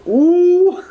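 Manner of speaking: high, loud ooh of shock; a sharp ooh at a burn; impressed ooh. Real, not acted. Reacting to Nicole's cutting remark with a loud ooh.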